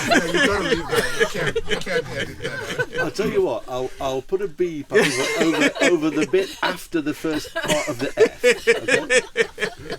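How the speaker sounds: high-pitched words breaking up with laughter; can barely get the words out for laughing; laughing hard. Real, not acted. Tour host laughing through his reply to a guest's cheeky joke.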